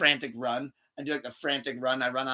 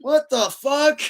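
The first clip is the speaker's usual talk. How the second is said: shocked yelp, pitched way up; high, loud shocked yell; 12 semitones higher, 9 dB louder, much slower than usual. Retold or imitated. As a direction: blurt it in total shock. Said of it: "he'd wake up in that chair".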